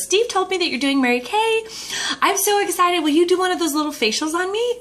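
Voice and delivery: put-on high, bubbly, eager girlfriend; excited friend. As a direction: gush like a thrilled friend. Excited friend Jody at the door.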